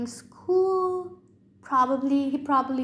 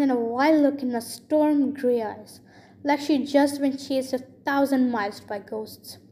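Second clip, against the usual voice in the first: ordinary delivery, nothing marked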